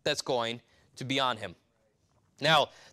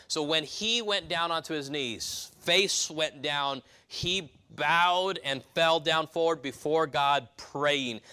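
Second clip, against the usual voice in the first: slow emphasis; slower than usual. Acted. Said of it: "slow emphatic explanation".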